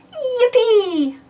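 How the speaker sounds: high delighted yippee; a piglet cheering with joy. A narrator's reading. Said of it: "cheered Peppa".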